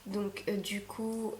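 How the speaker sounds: ordinary delivery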